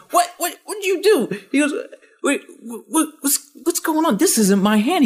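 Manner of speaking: he can't believe his own hand, voice shooting up; astonished. Retold or imitated. Kevin stunned his hand opens, high astonished stammer.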